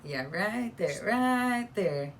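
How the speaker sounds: insistent pointing-out, rising in pitch and volume; emphatic repetition. Real, not acted. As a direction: build on each "right there", pitch leaping up. Pointing out the construction, repeating emphatically.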